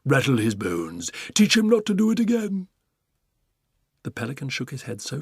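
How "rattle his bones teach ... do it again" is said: rising to a high loud urging; giraffe urging. Acted. Giraffe cheering the pelican on, high gleeful urging. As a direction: egg him on gleefully.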